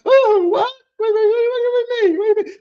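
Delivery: high, loud flustered "wait, wait"; panicked disbelief. Retold or imitated. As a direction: caught off guard and scrambling. Shocked reply to boss.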